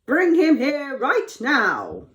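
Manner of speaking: slow, loud, high royal order; commanding Pharaoh. Acted. Pharaoh demands Joseph at once, imperious and loud. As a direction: demand it word by word. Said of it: "Pharaoh told him".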